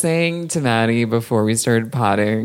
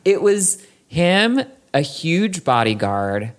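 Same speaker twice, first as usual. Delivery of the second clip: ordinary delivery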